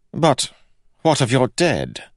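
high, loud, insistent king; pressing king. A narrator's reading. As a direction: press the question urgently. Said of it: "pressed the king".

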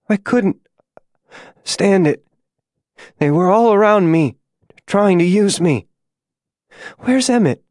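high, strained voice with halting pauses; struggling to speak. A narrator's reading. Dying June confides in her rescuers, weak halting high voice. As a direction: push the words out with failing strength. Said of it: "searching for the strength to form words".

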